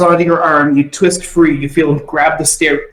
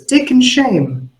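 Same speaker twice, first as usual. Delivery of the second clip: high, slow, drawn-out reading; reading the card; 10 semitones higher, slower than usual. Acted. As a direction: announce the words high and stretched. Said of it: "it says".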